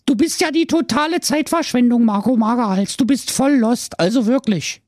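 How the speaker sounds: very high, sugary princess voice, mock sweet; sweet-voiced princess. The princess sweetly insults Marco, very high. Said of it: "sagte die Prinzessin mit lieblicher Stimme".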